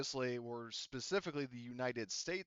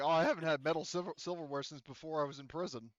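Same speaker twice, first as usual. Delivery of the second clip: casual boast; 5 semitones higher, 7 dB louder than usual. Retold or imitated.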